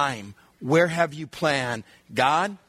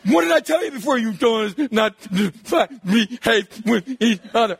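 louder, raised scolding voice; scolding dad; 5 semitones higher, 3 dB louder than usual. Retold or imitated. Dad scolding nine-year-old outside, raised voice. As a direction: tear into the boy.

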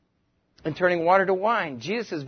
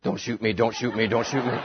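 mock panic; 8 semitones lower, 5 dB quieter than usual. Disciples fleeing in panic.

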